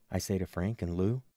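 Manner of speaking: plain reading voice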